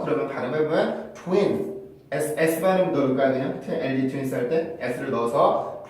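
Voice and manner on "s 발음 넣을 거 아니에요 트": usual speaking voice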